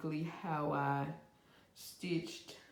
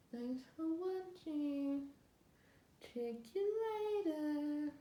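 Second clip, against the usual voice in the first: sing-song sign-off; 10 semitones higher than usual. Real, not acted. Second sign-off to viewers.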